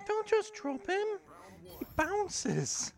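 put-on very high, loud baby talk. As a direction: squeak it playfully.

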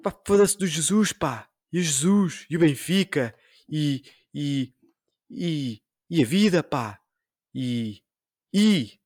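hammed-up swearing at everything; mock rant. S0 continuing David's swearing rant: hammed-up mock fury.

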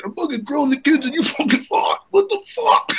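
very high mock rant; imitated furious coach; pissed-off coach. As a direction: squawk in exasperated disbelief. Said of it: "He's completely pissed".

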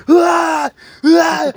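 high, loud imitated scream of pain; hammed-up injured howl; pained yell. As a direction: yell like you just busted your ankle. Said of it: "and he's yelling".

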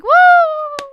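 loud high whoop of joy; excited woo. Real, not acted. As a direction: cheer it out. Excited cheer for the topic.

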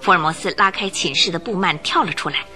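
usual speaking voice

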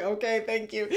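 thanks through a laugh. Retold or imitated. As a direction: say thank you while laughing.

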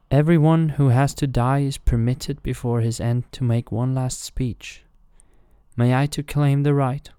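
a hint of a condemned servant; faithful servant. A narrator's reading. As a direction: ask for the right to speak, humbly. John's last-speech request.

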